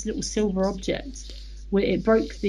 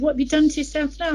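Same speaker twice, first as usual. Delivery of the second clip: retold dismay, pitched up and louder; alarmed husband; 8 semitones higher, 5 dB louder, faster than usual. Husband spots something on wife's back in shower, alarmed. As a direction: raise the voice in mock alarm. Husband discovers new marks on her.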